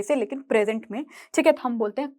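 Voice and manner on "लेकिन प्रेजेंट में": ordinary delivery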